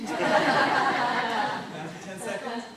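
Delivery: drawn out with laughter; slow laughing drawl. Shown as a demonstration. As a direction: stretch it slowly while laughing. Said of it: "Looks like this".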